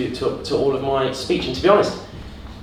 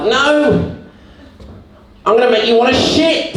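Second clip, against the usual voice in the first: higher, louder taunting bowel voice; the bowels threaten the performer, higher louder taunt; bowels' voice; 9 semitones higher, 4 dB louder than usual. Acted. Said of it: "your bowels are like".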